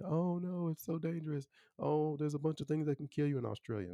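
high-pitched mock worry; mock alarmed worriers. Mimics people's worried opinions of Australia.

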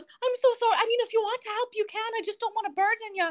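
high, fast apologetic woman; woman's voice. Acted. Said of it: "she goes".

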